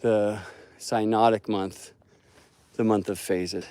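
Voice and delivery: ordinary talk, nothing marked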